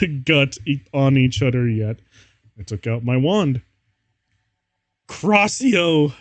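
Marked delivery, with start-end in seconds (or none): 5.1-6.1 mock spell shout. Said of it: "I shouted".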